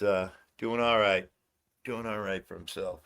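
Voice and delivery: ordinary talk, nothing marked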